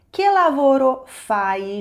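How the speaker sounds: emphatic slow repeat. Shown as a demonstration. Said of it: "Em italiano, você diz".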